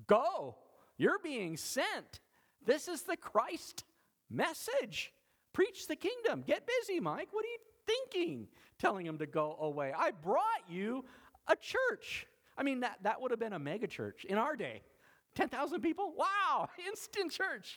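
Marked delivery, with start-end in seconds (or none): 0.1-12.3 high, drawn-out exhortation; high-pitched, emphatic sending-out; fervent charge. Acted. The Lord fervently rebuking Mike. 15.3-17.8 giddy, high-pitched astonishment; amazed. Acted. Amazed at instant megachurch.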